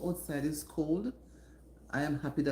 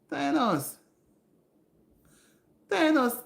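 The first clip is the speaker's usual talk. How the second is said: excited greeting; 10 semitones higher, 5 dB louder, slower than usual. Real, not acted. Greeting a viewer by name.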